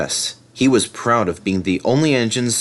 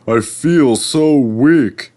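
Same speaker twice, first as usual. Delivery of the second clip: weak and slow; 4 semitones higher, 5 dB louder, much slower than usual. Acted. Gordon weakened.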